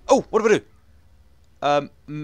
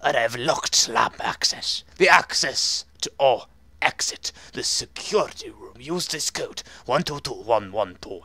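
slow, measured put-on voice; character voice; slower than usual. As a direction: read the note slowly, in character. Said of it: "I need to do this in a voice".

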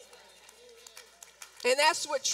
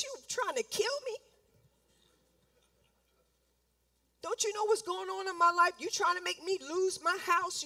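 higher, whiny accusing complaint to God; whining complaint; 4 semitones higher than usual. Retold or imitated. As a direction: whine at God like a hurt child. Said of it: "Instead of saying".

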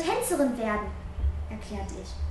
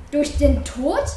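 narrated mild yell; 4 dB louder, slower than usual. A narrator's reading. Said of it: "brüllte ich".

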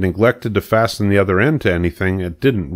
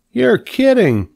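high, louder disbelief; astonished pig; 4 dB louder, slower than usual. A narrator's reading. Pig hears about seven leg sections, astonished. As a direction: squeal it in amazement as the pig.

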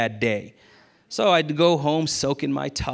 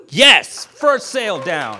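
shouted, much louder and higher, then a slow savouring; triumphant yes; 4 semitones higher, 9 dB louder, slower than usual. Real, not acted. Salesman celebrating his first sale, triumphant and loud. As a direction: punch the air on the first win.

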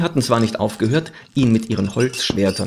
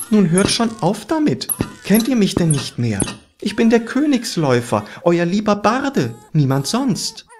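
high, exasperated plea; pleading bishop; 8 semitones higher than usual. A narrator's reading. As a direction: reason with attackers in a high voice. Bishop pleads with attacking towers.

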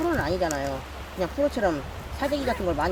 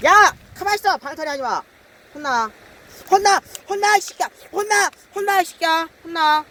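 much higher, louder scolding; scolding yell; 9 semitones higher, 6 dB louder than usual. Real, not acted. Angler yelling at thrashing bass, scolding shout. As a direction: yell at the thrashing fish to stay still.

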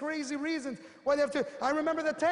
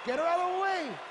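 raised, high-pitched mock outburst; exclaimed dismissal; 4 semitones higher than usual. Speaker dismisses the prospect's doubting mother, exclaimed dismissal. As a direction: call it out high and forceful.

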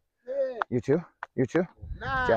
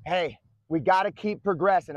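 normal talking voice

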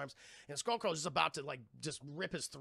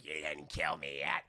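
much lower, slow monster voice; monster growl. Retold or imitated. Skullcrawler taunts Khan, slow and much lower. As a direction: growl it like a beast. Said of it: "When the Skullcrawler's like".

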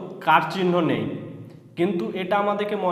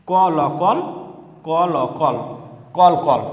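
loud drill syllables; 4 dB louder than usual. Shown as a demonstration. Teacher drilling children on কল, said loud and firm. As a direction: call out each sound, then the word. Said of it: "তার মানে এখানে কি".

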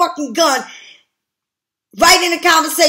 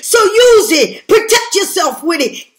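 slow, loud and higher, hammering each word; shouted urging; 3 semitones higher, 4 dB louder than usual. Real, not acted. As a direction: shout it at them like a rallying cry. Urging viewers to use their sword.

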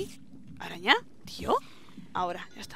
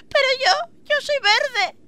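mock sobbing; 9 semitones higher than usual. Acted creeper romance scene.

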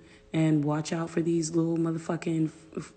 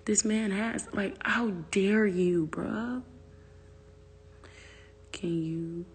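normal talking voice

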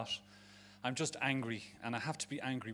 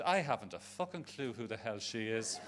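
ordinary talk

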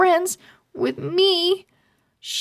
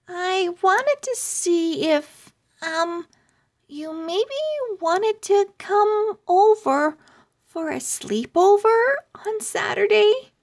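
put-on higher, hesitant little-girl voice asking shyly; shy young owl girl; 7 semitones higher than usual. Eva shyly inviting Haley to a sleepover, hesitant and higher. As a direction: nervously ask a new friend over, pitched up.

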